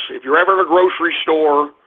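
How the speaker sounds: ordinary talk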